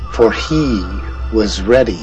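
plain reading voice

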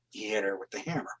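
hushed whisper, dropping right down. Real, not acted. Telling the host how the girl was killed, in a whisper. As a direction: breathe the grim detail almost silently. Grim detail of the killing.

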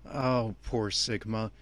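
ordinary talk

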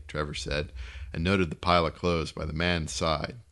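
ordinary delivery

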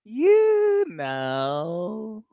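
long, drawn-out parrot punchline; drawling parrot voice; knowing parrot. Acted. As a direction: stretch both words out slowly like a taunting bird. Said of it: "the parrot looks at her and goes".

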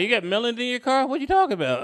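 high-pitched baffled questioning; confused cop. Acted. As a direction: play a police officer who's totally thrown. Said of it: "I confused him".

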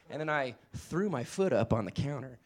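normal talking voice

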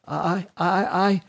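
high, halting stutter of panic; stammered. A narrator's reading. Ashlyn, caught with the inkwell, stammers in panic. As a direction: trip over the word in fright. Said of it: "stammered Ashlyn".

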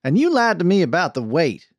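high-pitched indignant charge; angry accusation. A narrator's reading. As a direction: round on him angrily. She turns her anger on Archie.

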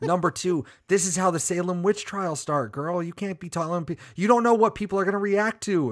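high-pitched, exasperated mock lecture; mock scolding. Telling stoned Tara off about witch trials, mock-exasperated. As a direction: scold a reckless friend, pitch climbing.

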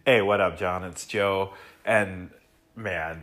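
ordinary talk, nothing marked

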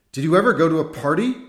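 high, loud and fast; excited. A narrator's reading. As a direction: fire it off eagerly. Priest excited question.

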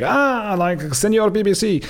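high-pitched mock cry of delight; delighted greeting. Exorcist greets the supposed BBC guy, mock-delighted. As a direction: hail him effusively, imitating an excited exorcist.

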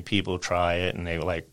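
ordinary talk

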